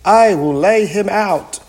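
loud, high-pitched giant's threat; storybook giant crying out a threat. A narrator's reading. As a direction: boast it loudly at the top of your range. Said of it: "cried the giant".

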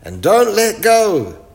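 high, loud call to the others. Acted. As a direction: call up to them to hold tight. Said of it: "called Wilf".

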